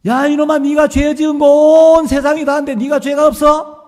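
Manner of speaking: high, loud scolding shout; thundering rebuke. Retold or imitated. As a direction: thunder at the boy. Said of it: "호통을 치시면".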